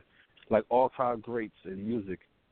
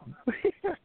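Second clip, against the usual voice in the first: high-pitched laugh. Real, not acted. Laughs at own slip.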